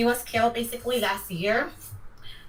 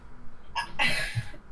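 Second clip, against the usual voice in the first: quiet, breathy nervous laugh; a small laugh holding back tears; soft tearful laugh; 5 dB quieter than usual. Real, not acted. Grieving sister laughs off tears.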